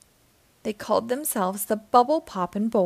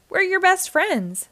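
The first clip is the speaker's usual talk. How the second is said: pleading; 10 semitones higher, 4 dB louder than usual. A narrator's reading. Friends plead with SpongeBob.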